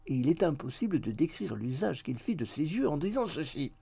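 plain reading voice

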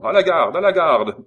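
high, very loud call for help; shouting for the guard. Acted. Simon calling the guard for help, a loud high shout. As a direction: yell for the guard at full voice. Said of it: "s'écria Simon".

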